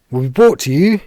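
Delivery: loud build-up, pitch climbing to the end; suspenseful announcement. Acted. Game player teasing viewers with a cliffhanger, suspenseful shout.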